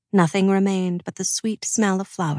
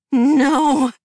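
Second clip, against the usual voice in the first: loud, high cry of horror; anguished cry; 8 semitones higher, 7 dB louder than usual. Acted. Mackay cries out as darkness falls on the children, loud high cry. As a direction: cry out as the shadow takes them. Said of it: "Mackay cried".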